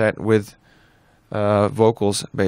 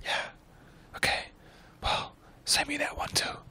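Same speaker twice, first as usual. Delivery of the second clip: hushed phone whisper; 13 dB quieter, slower than usual. Retold or imitated. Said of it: "we noticed we were whispering".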